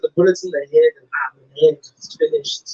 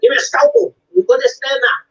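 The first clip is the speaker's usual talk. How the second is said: loud, high mock surgeon; lightly hammed-up doctor calling for tools; surgeon giving orders; 12 semitones higher, 5 dB louder than usual. Doctor to team over mom's operation, mock urgent order.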